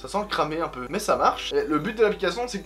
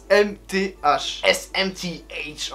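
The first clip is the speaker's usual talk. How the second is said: slow spelled-out letters in an English accent; much slower than usual. Shown as a demonstration. Spelling the app name to viewers in English accent. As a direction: spell it out slowly, American English. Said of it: "pour les gens qui parlent anglais".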